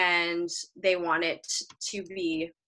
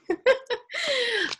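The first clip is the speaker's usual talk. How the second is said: a sudden high burst of laughter. Real, not acted. Laughing after shooing the cat.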